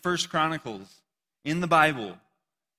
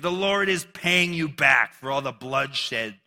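scripture reading; 5 dB louder than usual. Acted. Shimei's curse read on.

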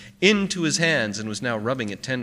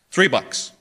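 short, louder, higher quote of a price; name the price crisply, without hesitating; 5 semitones higher, 7 dB louder than usual. A narrator's reading. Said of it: "He said crisply".

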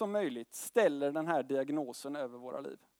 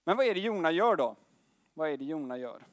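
usual speaking voice

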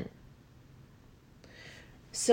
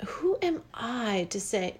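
ordinary delivery

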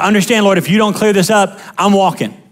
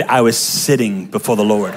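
much lower, soft, with laughter; mock pious husband; 11 semitones lower, 4 dB quieter than usual. Husband dodging chores, mock holy and low. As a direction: play the smug saintly husband with a chuckle. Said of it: "Babe, you didn't make the bed".

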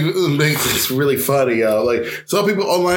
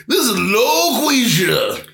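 hammed-up, drawn-out, higher character voice; leaning into it; 7 semitones higher, much slower than usual. Co-host mocks the director relishing his character, hammed-up. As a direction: stretch it out with relish, mocking him. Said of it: "he was leaning into that shit".

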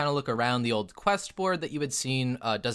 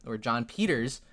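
ordinary talk, nothing marked

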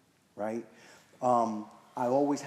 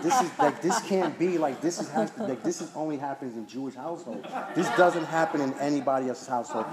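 high, louder, laughing through the words; laughing astonishment; 4 semitones higher, 6 dB louder than usual. Retold or imitated. Recalling his girlfriend's family dinner, laughing in amazement. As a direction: marvel at it while laughing.

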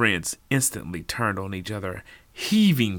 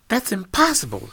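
high loud cry of protest; shouted denial; 12 semitones higher, 5 dB louder than usual. Acted. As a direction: shout it, shocked. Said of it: "shouted Tina".

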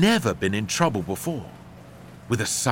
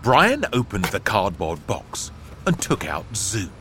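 usual speaking voice

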